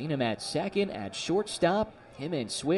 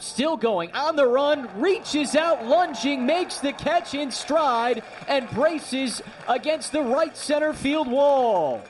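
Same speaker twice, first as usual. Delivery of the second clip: building to a loud, high shout; excited call; 8 semitones higher, 3 dB louder than usual. Real, not acted. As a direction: ride the catch up to the wall. Deep fly caught at wall.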